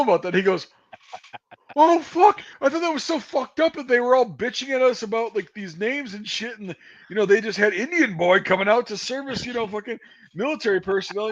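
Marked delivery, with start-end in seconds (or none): 1.8-11.3 high-pitched quoted voice, exclaiming; high, animated retelling of someone else; imitated incredulous reaction. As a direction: act out his reaction, pitched well up.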